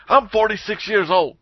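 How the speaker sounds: high, mock-insistent declaration; homeless man's announcement. Homeless man to everyone in line, high insistent announcement. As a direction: announce it like a pushy street hustler. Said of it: "some forty-six-year-old homeless man".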